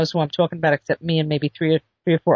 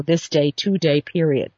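ordinary delivery

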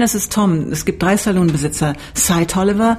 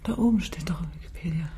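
geflüstert; 13 dB quieter, faster than usual. Real, not acted. Leiser Hinweis während der Aufnahme.

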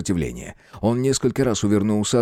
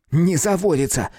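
irritated; 7 semitones higher than usual. Acted. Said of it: "не менее раздражённо ответил тот".

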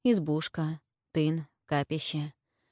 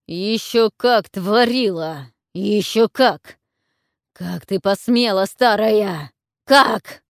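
loud furious shout, rising in fury; beastly roar; 3 semitones higher, 9 dB louder, slower than usual. A narrator's reading. Warlord roars at the witch over the shrine, furious. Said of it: "звериный рык воеводы".

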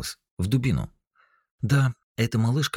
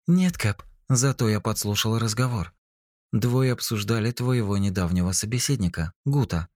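high female AI voice; 7 semitones higher than usual. A narrator's reading. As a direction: report like a loyal AI. AI Eva reports.

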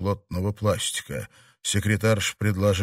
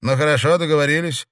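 smirking; 6 semitones higher, 4 dB louder, faster than usual. A narrator's reading. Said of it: "с усмешкой сказала она".

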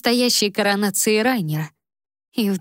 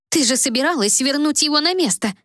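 higher, pleading outcry; upset exclaim; 7 semitones higher than usual. A narrator's reading. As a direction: cry out in dismay. Сестра в отчаянии возражает. (встревоженно восклицая)

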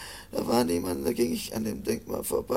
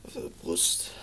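pained; 7 semitones lower, 8 dB quieter than usual. Acted. Wounded man in pain.

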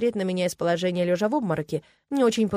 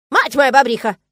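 loud, high beaver-wife cry; startled exclamation; 6 semitones higher, 11 dB louder than usual. A narrator's reading. Beaver wife spots the strangers, loud startled exclamation. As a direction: exclaim in astonishment, pitched up. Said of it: "воскликнула та".

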